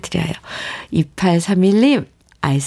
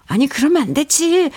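higher-pitched imitation of a meddling TV viewer; mock meddling; 8 semitones higher than usual. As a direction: playfully butt in on the drama. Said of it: "막 참견하잖아요".

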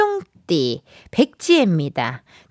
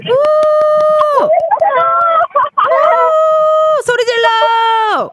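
high whooping cheer; hype shout; 4 dB louder, much slower than usual. Real, not acted. Radio host whooping to hype up caller.

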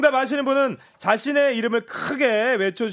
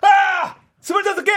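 loud, high exclamation; excited shout; 13 semitones higher, 7 dB louder than usual. Real, not acted. Host reacts to quiz answer.

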